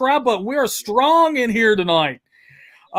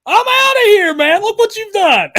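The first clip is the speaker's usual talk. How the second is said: high, loud mock huffy exit; hammed-up, much higher and louder pretend walkout; mock viewer quote; 9 semitones higher, 7 dB louder than usual. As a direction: play a jilted viewer storming off. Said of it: "Chad Edmonds is already saying".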